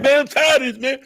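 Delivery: high, loud whiny complaint; loud mocking imitation of an angry rapper; mock mad rapper. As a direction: imitate a rapper fed up and griping. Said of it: "as soon as they get mad".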